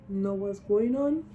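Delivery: normal talking voice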